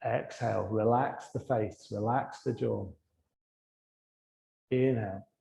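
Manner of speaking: low, soft cues with long silences between; guided breathing meditation. Real, not acted. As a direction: calmly guide each breath, letting the words fade. Leads a breathing exercise.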